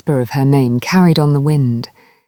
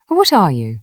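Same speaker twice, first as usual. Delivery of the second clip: usual speaking voice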